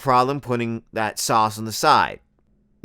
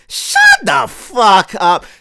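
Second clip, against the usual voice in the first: slow, drawn-out yell, much higher and louder; furious shout; 8 semitones higher, 6 dB louder, much slower than usual. Acted. Comedian yelling at a preachy poster to shut up, drawn out. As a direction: bellow each word slowly at the preachy guy.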